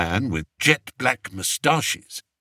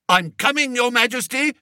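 high, urgent call; cried out; 11 semitones higher, faster than usual. A narrator's reading. Beamish calling into fog to his king, urgent. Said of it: "cried Major Beamish".

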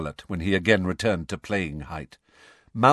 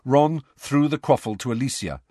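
usual speaking voice

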